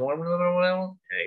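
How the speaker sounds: indistinct run of mumbled syllables; mumbling. Shown as a demonstration. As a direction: mumble without forming any clear words. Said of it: "Jangan ngomong guma-, menggumam gitu".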